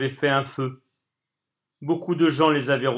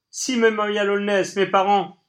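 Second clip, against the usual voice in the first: indignant shout; 8 semitones higher, 6 dB louder than usual. Acted. Toby still shouting at Colline.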